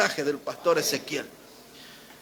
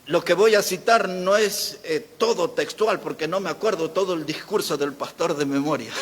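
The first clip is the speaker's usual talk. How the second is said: ordinary delivery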